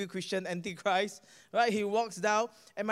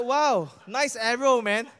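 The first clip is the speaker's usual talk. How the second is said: shocked; 3 semitones higher, 8 dB louder, slower than usual. Retold or imitated. Preacher caught off guard when his friend hands over. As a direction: blurt out the shock.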